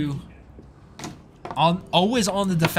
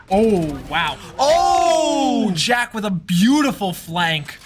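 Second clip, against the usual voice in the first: excited; 5 semitones higher, 6 dB louder, much slower than usual. Real, not acted. Caster reacts to big flank.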